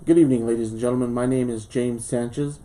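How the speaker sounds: ordinary talk, nothing marked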